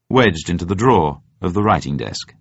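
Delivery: ordinary delivery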